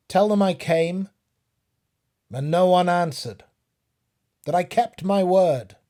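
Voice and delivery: high, loud calling out to an empty house; traveller's shout. Acted. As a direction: shout up at the dark windows. Traveller calls to silent house.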